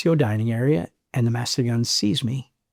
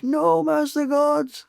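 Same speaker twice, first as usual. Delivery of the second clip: high, slow, drawn-out mock chorus; hammed-up high, slow chorus reply; dopey chorus answer; 11 semitones higher, much slower than usual. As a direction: play the dumb Marines answering in unison. Marines answering in unison.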